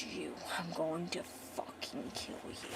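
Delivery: very soft, low menacing whisper; whispered threat. Retold or imitated. Callum to the killer, low whispered menace. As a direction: threaten him under your breath, acted. Retold vow to kill the villain.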